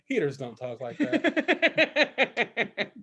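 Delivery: teen mocking his own hallucination, laughing slowly; laughing disbelief. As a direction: drag it out while cracking up. Amused realization while tripping.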